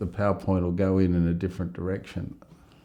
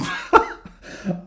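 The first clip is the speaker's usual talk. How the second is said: real laugh; 12 semitones higher, 3 dB louder than usual. Real, not acted. Teller cracks up at own story.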